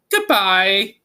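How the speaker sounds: high little mouse voices calling goodbye; squeaky mice reply. Acted. Mice answer their queen goodbye, high and squeaky. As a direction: chirp it like a crowd of mice.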